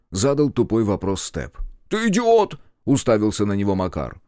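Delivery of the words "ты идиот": very high, loud retort; high-pitched, indignant cry; outraged insult. A narrator's reading. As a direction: fling the insult back. Макар rounds on Степ.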